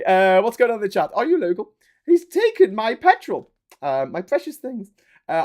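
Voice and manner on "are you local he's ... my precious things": high, loud grotesque sketch character; comic character voice. Acted. Local accuses stranger over petrol, high comic character voice. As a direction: wail it in a high put-on voice.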